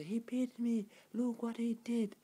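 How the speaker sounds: loud, high-pitched accusing cry; mock screaming. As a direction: act out a wife crying out for witnesses. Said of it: "she start to scream".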